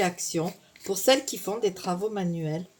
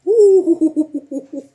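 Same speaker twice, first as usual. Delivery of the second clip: sharp pained ouh; 7 semitones higher, 11 dB louder than usual. Real, not acted. Touches hot paint.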